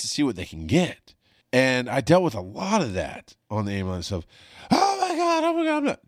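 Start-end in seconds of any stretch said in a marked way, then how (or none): 4.7-6.0 very high, shrill-pitched frantic wail; panicking patient. Retold or imitated. Patient in the ambulance, mock hysterical high wail.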